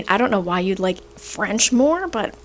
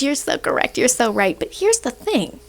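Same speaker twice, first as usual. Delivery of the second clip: ordinary delivery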